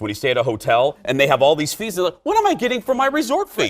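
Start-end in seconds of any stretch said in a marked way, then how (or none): 2.2-3.7 higher-pitched aggrieved question; mock exasperation; acted indignant complaint. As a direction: play the baffled, put-out hotel guest. Acts out aggrieved hotel guest's complaint.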